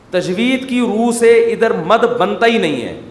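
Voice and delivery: ordinary talk, nothing marked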